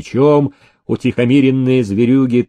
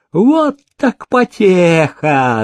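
put-on slow, higher gloating old master; gleeful paralytic; 6 semitones higher, much slower than usual. A narrator's reading. Paralytic gloating at beggars torn by dogs, slow glee. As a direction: savour the cruel fun slowly.

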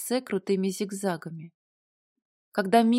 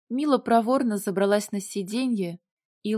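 plain reading voice

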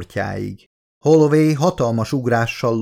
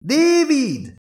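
high, drawn-out call once more; calling again. A narrator's reading. Elizabeth calling the silent android again, high shout. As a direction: call his name again, reaching far. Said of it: "kiáltott fel ismét a nő".

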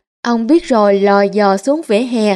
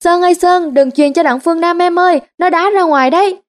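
shouting; 6 semitones higher than usual. A narrator's reading. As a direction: yell to the player through the radio. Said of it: "Ông Biết Rồi hét". (hét to cổ vũ trận bóng)

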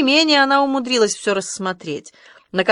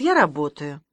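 curt cut-off; 5 semitones lower than usual. A narrator's reading. Ruth telling the boy she is working, curtly. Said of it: "отрезала Рут".

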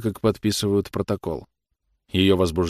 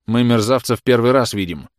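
louder, raised insolent retort; irritating retort; 4 semitones higher, 6 dB louder than usual. A narrator's reading. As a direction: needle the officer with a loud comeback. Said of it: "прозвучал раздражающий голос Николая".

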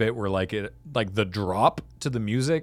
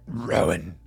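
lower, choked Gollum rasp; 6 semitones lower than usual. Retold or imitated. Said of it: "sound like Gollum there".

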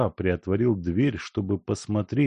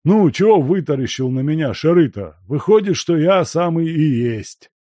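loud, higher gruff old man mocking; teasing old miner; 8 semitones higher, 7 dB louder than usual. A narrator's reading. Guest teasing the stunned servant, loud and mocking. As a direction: rib an old friend loudly.

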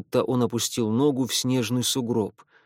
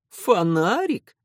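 «Фонарик?» drawn out slow, high and rising; mocking smirk; 7 semitones higher, 3 dB louder, slower than usual. A narrator's reading. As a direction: echo «Фонарик?» with a sneering laugh. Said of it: "тут же усмехнулся вампир". (насмешливо)

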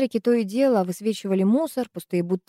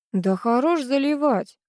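slow scoff, pitched up; unsure scoff; 5 semitones higher, slower than usual. A narrator's reading. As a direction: scoff without much conviction. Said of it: "без особой уверенности в голосе".

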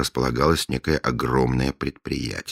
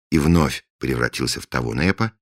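usual speaking voice

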